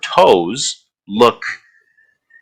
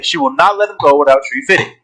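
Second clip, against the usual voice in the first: usual speaking voice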